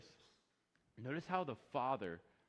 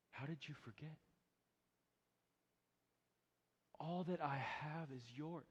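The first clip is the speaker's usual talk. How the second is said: much softer, lower fatherly reassurance; tender father; 3 semitones lower, 7 dB quieter than usual. Retold or imitated. Father reassures his resentful son, tender and soft. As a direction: speak it gently, like a loving father.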